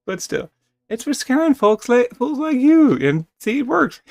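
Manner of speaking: high, loud gleeful taunt; high-pitched teasing brag; excited teasing. Acted. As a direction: crow that it scared him. Kit teasing loudly.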